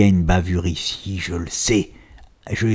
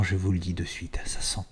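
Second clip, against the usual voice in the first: hushed secret whisper, almost no voice; 4 semitones lower, 11 dB quieter than usual. Acted. To viewers, whispering that the chart smells bad. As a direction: lean in and whisper a warning.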